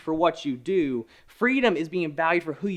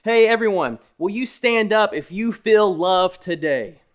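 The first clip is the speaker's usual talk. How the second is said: calling out; 5 semitones higher, 5 dB louder than usual. Pretend. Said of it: "I was like".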